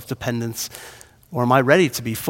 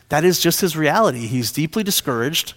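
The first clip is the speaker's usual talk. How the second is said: normal talking voice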